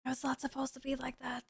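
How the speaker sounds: soft unvoiced whisper; whispered aside. Real, not acted. Streamer confessing to chat in a whisper. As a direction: mutter it under your breath.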